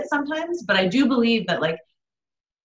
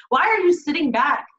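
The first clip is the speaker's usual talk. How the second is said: emotional plea; 6 semitones higher, 3 dB louder than usual. Real, not acted. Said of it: "I'm getting emotional right now".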